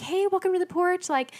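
chirpy hello at the door; cheerful greeters. Retold or imitated. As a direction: mimic overly happy church greeters. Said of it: "They're like".

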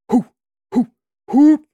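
high, loud acted "hoo" bursts; three hard drill breaths, voiced high; forceful breathing whoops. A narrator's reading. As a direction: punch out each hoo, the last loudest. Breathing drill during imagined drop.

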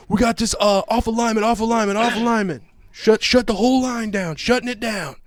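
urgent high-pitched yelled codes; mock yelling. Guard shutting down the scanner, mock yelling. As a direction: call out alarm codes high and repetitive. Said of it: "starts yelling out all these codes".

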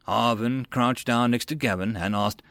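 usual speaking voice

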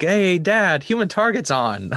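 put-on high, eager little-kid voice; excited kid. To his dad, as an excited kid calling him to the TV.

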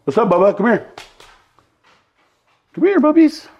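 high squeaky dog-talk voice; squeaky praising a dog. Real, not acted. Talking to the dog.

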